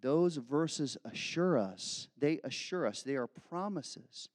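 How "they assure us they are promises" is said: ordinary talk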